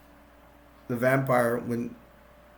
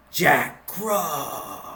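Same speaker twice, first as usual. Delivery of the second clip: menacing vampire voice. Acted. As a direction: say the name like a creeping threat. Vampire says hero's name.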